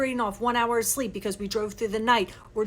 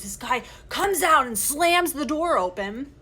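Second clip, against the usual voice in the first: ordinary talk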